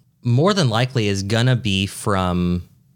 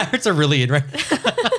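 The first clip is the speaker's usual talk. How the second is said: higher, laughing through the line; pitched-up laughing quote; laughing catchphrase; 5 semitones higher than usual. Retold or imitated. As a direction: giggle it as an in-joke. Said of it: "I say that to Danny all the time".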